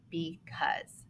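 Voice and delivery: the word said softly, falling. Shown as a demonstration. Teacher softly repeating the word for the class, quiet. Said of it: "How many syllables are in".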